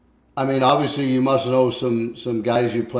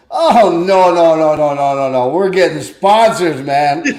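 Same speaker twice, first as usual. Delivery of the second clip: higher, louder no no no; Mike protesting; 4 semitones higher, 9 dB louder than usual. Retold or imitated. Mike answering the wife's joke about fast food, a louder protest. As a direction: wave off the idea, laughing loud. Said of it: "Mike goes".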